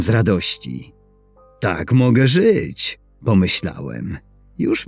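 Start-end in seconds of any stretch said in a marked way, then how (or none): none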